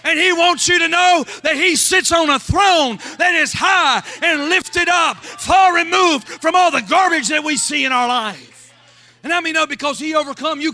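loud, high, shouted preaching; shouted gospel fervor; full-force preaching. Real, not acted. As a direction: preach it to the rafters.

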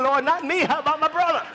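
high, mock-pleading protest; pleading dodge. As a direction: beg off and offer someone else instead. Brother dodging the same call.

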